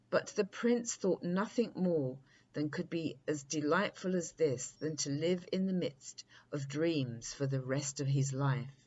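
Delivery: ordinary delivery, nothing marked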